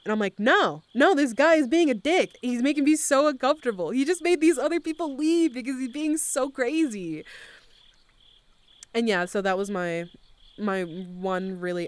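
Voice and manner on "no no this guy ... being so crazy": high, loud retold protest; upset complaint. Answering the DJ who asked if she's okay, upset complaint. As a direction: pour out an exasperated complaint.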